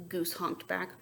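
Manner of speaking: ordinary delivery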